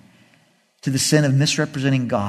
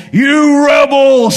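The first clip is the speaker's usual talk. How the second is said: slow, drawn-out furious shout, higher; angry yell; 11 semitones higher, 8 dB louder, much slower than usual. Retold or imitated. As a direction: bellow at the rebels. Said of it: "he starts yelling at them".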